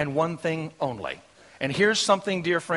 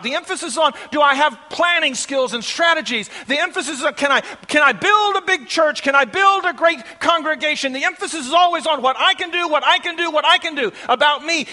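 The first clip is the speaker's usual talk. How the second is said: high, loud, mounting pulpit tirade; full-force preaching; 9 semitones higher, 8 dB louder than usual. Real, not acted. Preacher decrying self-centred ministry, full-force preaching. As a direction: pile up each phrase, building to a shouted peak.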